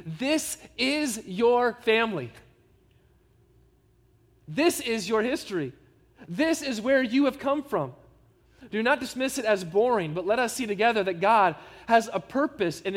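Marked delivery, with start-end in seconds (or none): 0.1-7.9 slow, stress on "your" in "this is your family"; emphatic. Shown as a demonstration. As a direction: press hard on "your" and "this", slowly. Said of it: "let me say emphatically".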